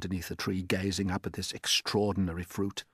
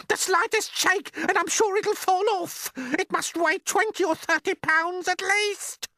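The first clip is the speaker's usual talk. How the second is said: a far higher, louder aunt's shout; Aunt Spiker shouting; 5 dB louder than usual. Acted. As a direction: screech it out as the nasty aunt. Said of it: "Aunt Spiker yelled".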